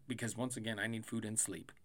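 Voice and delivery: normal talking voice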